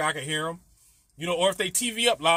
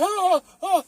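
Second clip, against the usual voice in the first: high, louder mock moans; mock moaning; 11 semitones higher, 5 dB louder than usual. Imitating the neighbor upstairs, high mock moans. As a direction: ham up the moans again. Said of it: "she was like".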